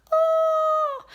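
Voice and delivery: excited. Real, not acted. Thinking of Hawaii.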